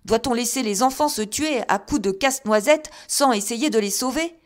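loud, high exasperated protest; husband driven to the end of his patience protests, loud and high. A narrator's reading. Exasperated husband protesting loudly to his wife. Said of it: "poussé à bout".

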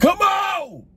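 sudden high furious shout; calm turning angry. Real, not acted. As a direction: explode after pleading. Patience snaps after pleading.

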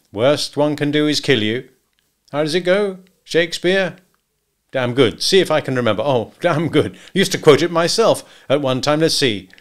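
groping happily for a favourite quote; Wilson, approving. Acted. Hunter recalls a line of Shakespeare, approving.

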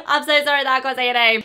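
giggling sorry, fast and loud; laughing apology. Real, not acted. Laughing at mispronouncing a name.

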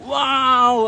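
a high, wide-eyed wow; amazed wow. Real, not acted. Vlogger marvelling at his friend's huge tilapia catch, amazed wow.

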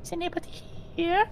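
squeaky falsetto calling out; high-pitched sing-song call; high maid voice. Pretend. Keeps the maid act going.

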